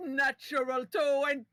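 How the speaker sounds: shouted in triumph; excited shout. Real, not acted. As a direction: yell it out in delight. Shouting a natural twenty.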